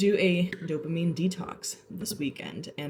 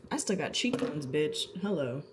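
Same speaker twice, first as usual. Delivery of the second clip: ordinary delivery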